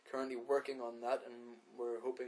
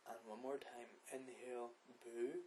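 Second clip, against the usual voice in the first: guided meditation; 14 dB quieter than usual. Acted. Podcast host guiding listeners through breathing, soft meditation voice. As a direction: lead the breathing gently with long pauses.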